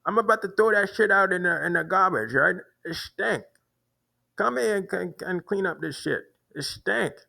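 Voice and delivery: high-pitched imitation of the complaint; mimicking the man's demand to the guard, pitched high; imitating the man. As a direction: play him up high and insistent. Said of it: "that's how they speak".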